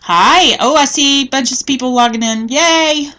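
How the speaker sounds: bright, loud, high hello bursting out; excited greeting. Real, not acted. Crafter greeting viewers logging in, loud excited welcome. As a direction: cheer as the viewers pour in.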